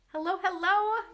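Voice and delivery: ordinary delivery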